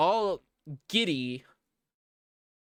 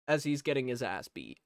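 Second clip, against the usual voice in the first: ordinary delivery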